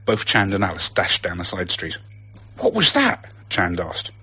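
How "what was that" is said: high, loud startled question; urgent, high-pitched bewilderment; alarmed question. A narrator's reading. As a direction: ask in alarm while fleeing. Fleeing from an attack.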